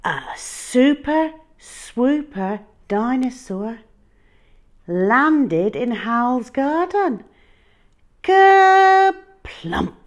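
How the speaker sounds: bright, high storybook narration; reading to children. A narrator's reading. Reading a picture book to a child, lively and high. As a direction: read it big for little listeners.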